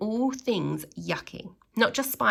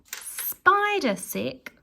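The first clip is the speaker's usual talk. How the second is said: very slow, higher, grossed-out; disgusted; 8 semitones higher, much slower than usual. Acted. Reader reads the spider sick label slowly, grossed out. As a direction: savour the yuck slowly.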